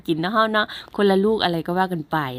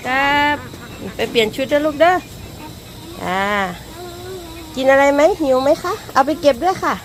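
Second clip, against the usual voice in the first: high, louder coaxing voice; sweet motherly talk; 8 semitones higher, 3 dB louder than usual. Real, not acted. Mother fussing over kids after school.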